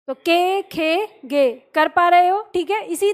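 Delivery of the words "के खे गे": के, खे, गे each ending in a long "ay" vowel; consonants with e. Shown as a demonstration. Teacher has pupils use the e vowel, के, खे, गे drilled distinctly. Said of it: "ए की मदद से करो".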